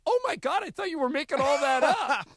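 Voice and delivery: shocked laughing squeal. Retold or imitated. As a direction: gasp it out, laughing in disbelief. Friend shocked in retold story.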